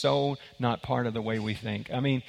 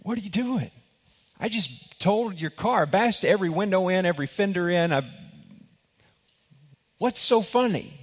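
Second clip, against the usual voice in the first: baffled cowboy; 6 semitones higher than usual. Retold or imitated. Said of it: "And the guy goes".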